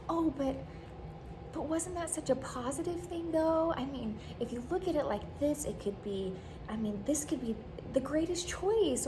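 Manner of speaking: mock cheerful friend. Imitating an unhelpful upbeat friend.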